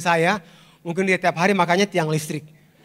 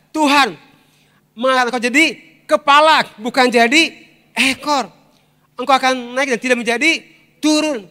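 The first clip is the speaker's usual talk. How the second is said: preaching at full force; 4 semitones higher, 5 dB louder than usual. Acted. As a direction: proclaim it to the congregation, high and forceful.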